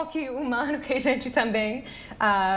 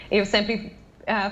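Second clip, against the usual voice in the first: ordinary delivery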